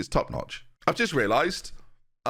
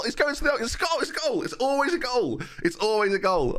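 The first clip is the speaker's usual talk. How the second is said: much higher, rapid rising excitement; excited goal reaction; 11 semitones higher than usual. Real, not acted. Gamer cheering on a goal he predicted, excited. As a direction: burst out in high-pitched disbelief and glee.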